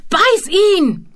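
very high, loud shrieked command; shrieking. Acted. Bowman urging Mumpo to bite the hand, shrieking. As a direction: shriek the order frantically. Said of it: "kreischte Bowman".

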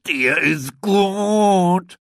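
high, slow, loud amused voice; laughing elephants. A narrator's reading. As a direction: chortle it like a laughing herd of elephants. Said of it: "lachten die Elefanten".

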